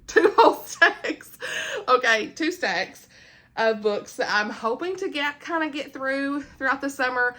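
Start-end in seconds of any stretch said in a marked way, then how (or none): 0.1-1.4 loud, high, drawn-out laughing repeat; amused, slow and emphatic, pitched up; laughing emphasis. Real, not acted. Laughing repeat after correcting the count.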